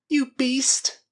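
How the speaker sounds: high, loud accusing cry; bitter accusation. Acted. Girl to the man facing her, high bitter cry.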